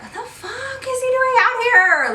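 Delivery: stunned to see him there; high, disbelieving at the ex showing up; baffled. Retold or imitated. Baffled at seeing ex.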